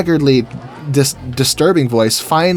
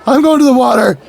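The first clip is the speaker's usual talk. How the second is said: balloon's voice; 10 semitones higher, 6 dB louder than usual. Retold or imitated. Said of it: "He's like".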